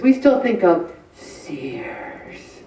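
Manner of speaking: slow whispered aside; slow, fond whisper. Real, not acted. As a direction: whisper it like a shared secret. Fond aside about Sears.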